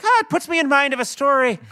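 put-on high-pitched, hurried Belfast accent. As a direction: imitate an excitable Belfast storyteller. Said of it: "then I start talking that way".

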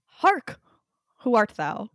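hammy stage actor. Acted. Hammy theatrical challenge to stranger.

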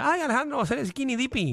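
mucho más aguda, voz de chisme exagerado; voz de amiga emocionada. Retold or imitated. Imagines a friend's excited remark. (voz aguda de chismosa)

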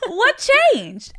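high, loud, drawn-out CHANGED; astonished question. Retold or imitated. Therapist asking about the change of heart, astonished. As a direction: imitate an amazed therapist, laughing through it.

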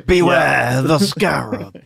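slow, drawn-out spooky mummy warning; mock-ominous, stretched-out words; haunting mummy. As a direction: intone it like a tomb-haunting mummy, hammed-up.

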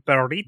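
"burrito" with excessively rolled r; exaggerated Spanish pronunciation. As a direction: show off a heavily accentuated "burrito". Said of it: "most excessively rolled, uh, accentuated".

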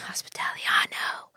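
breathy whispered aside. Real, not acted. To the hosts about Olive Garden, a jokey whisper. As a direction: whisper it like a private joke. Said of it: "joking fake Italian word".

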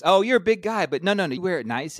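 high, loud, fast fake-kind consolation; hammed-up patronizing comfort; mock reassuring acquaintance. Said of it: "people reassuring him about weight".

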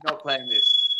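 normal talking voice

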